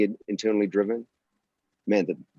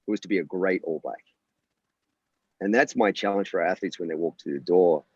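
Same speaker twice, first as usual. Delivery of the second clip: ordinary delivery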